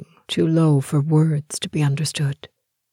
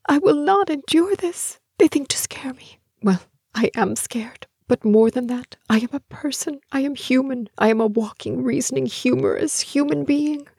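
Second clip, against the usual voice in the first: defiance building; 10 semitones higher than usual. A narrator's reading. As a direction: work yourself up from inner defiance towards a yell. Defiant inner speech building to shout.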